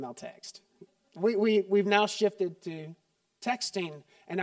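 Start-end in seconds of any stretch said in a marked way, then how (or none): 0.0-0.6 hushed, breathy throwaway; whispered aside. Acted. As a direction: drop it to a secret-sounding aside. Aside about avoiding calls.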